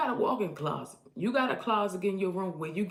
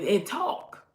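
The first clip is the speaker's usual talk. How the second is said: ordinary talk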